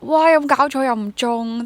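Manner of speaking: loud, high mock disbelief; exasperated outburst. Actor reliving a failed casting for the host, loud mock dismay. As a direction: throw up your hands in retold dismay.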